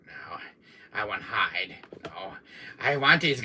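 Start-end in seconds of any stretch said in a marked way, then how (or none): none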